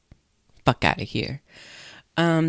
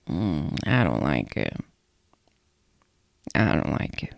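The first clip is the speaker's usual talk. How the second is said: mock distaste; 10 semitones lower than usual. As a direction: drop low and drag out the dislike. Acts out disliking the performance.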